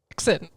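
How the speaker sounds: "Accent" said as 'ccent; "Accent" with an apostrophe for its A; dropped-A accent. Shown as a demonstration. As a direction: swallow the first vowel of "Accent". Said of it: "it doesn't even have the A".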